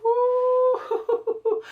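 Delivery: very high, loud whoop breaking into laughter; thrilled whoop with a laugh. Real, not acted. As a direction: whoop in real delight, laughing. Thrilled by the future matchup.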